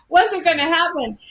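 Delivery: ordinary talk, nothing marked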